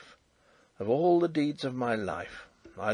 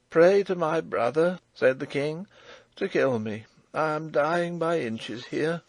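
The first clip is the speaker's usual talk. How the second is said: faint hollow king; 5 semitones higher, 4 dB louder than usual. A narrator's reading. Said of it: "faint and hollow".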